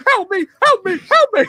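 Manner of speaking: very high, loud imitated pleading; mock cries for help. Fan imitating YouTuber stuck on stairs, high mock panic.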